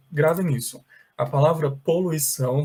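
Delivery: ordinary delivery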